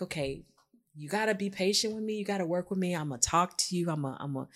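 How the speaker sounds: soft, hushed plea for patience; quiet pleading. Retold or imitated. Asking her partner softly to be patient with her. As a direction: ask gently, almost under her breath. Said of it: "So it's like".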